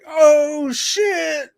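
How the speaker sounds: high, drawn-out mock holler; excited recognition. Friend's matching surprised reply, acted.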